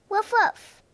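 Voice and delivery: very high puppy woof woof; high dog bark. Pretend. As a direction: yip goodbye like a puppy. Dog barking goodbye.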